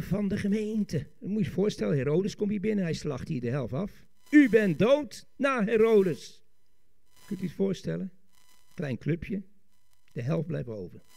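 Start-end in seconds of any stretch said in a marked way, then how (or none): 4.3-6.4 loud, slow verdict; dramatic pronouncement. Acted. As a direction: act out a death sentence from the pulpit. Acts out Herod's slaughter. (luid en dreigend uitgesproken)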